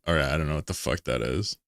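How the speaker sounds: normal talking voice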